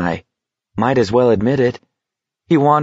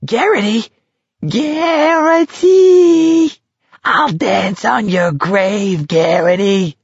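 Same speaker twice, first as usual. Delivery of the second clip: shrieking high, words stretched out long; crazed screech rising far above normal voice; mad cackling taunt; 4 dB louder, much slower than usual. A narrator's reading. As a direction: taunt him like a cackling madman. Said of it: "Barkovich was cackling, higher in matter".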